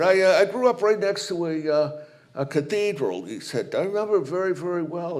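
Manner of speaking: breezy big-guy hello, lightly voiced; coach greeting a nun. Coach greeting the nun at the banquet, lightly voiced jock.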